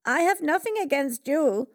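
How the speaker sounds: cornered Haman pleads with the queen; frightened protest. A narrator's reading. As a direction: plead in panic. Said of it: "Haman trembled".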